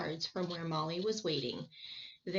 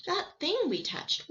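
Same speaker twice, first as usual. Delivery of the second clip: higher, eager; excited; 10 semitones higher, 3 dB louder than usual. Acted. Boy asking about the magic, excited. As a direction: recall it in wonder.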